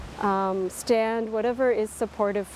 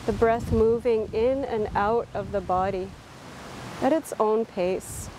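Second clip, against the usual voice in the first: unhurried, calm body-scan voice; guided meditation. Real, not acted. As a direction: guide them gently through a body scan, leaving space between phrases.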